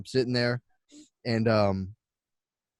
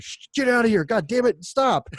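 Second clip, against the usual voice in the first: high, loud panicked hissing yell; frantic shout; 10 semitones higher, 4 dB louder than usual. Acted. Husband frantically yelling at his brother to leave, high and loud. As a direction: shoo him out in a frantic outburst.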